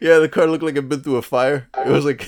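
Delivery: loud, high, laughing recollection. Real, not acted. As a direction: tell it while cracking up. Laughing over shared car memory.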